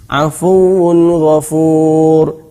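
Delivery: Afuwun ghafur very slow, tanwin clear; Quran recitation. Shown as a demonstration. Teacher giving tanwin before ghain example. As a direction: chant Afuwun ghafur slowly. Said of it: "Tanwin bertemu ghain".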